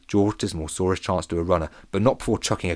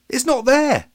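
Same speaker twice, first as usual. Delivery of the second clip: high, loud, winded alarm; out of breath; 6 dB louder, slower than usual. A narrator's reading. George runs back to report the trophy missing, winded. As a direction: blurt it out after running. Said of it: "trying to get his breath".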